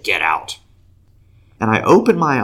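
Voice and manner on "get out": low, firm retold command; a little attitude. Man commanding pain to leave, a little attitude. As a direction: order it out with some attitude, pitched low. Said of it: "had a little attitude in my voice".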